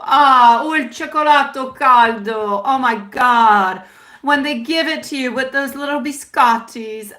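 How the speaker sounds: put-on slow, savouring Italian accent; talking Italian. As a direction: linger over each Italian word like a café romantic. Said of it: "talking Italian".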